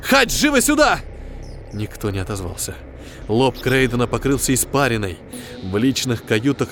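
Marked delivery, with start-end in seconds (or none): 0.0-1.0 urgent call. A narrator's reading.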